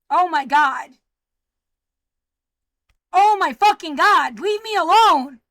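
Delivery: loud exasperated cry building to a shout; angry outburst. Real, not acted. Speaker to people harassing them at home, angry and loud.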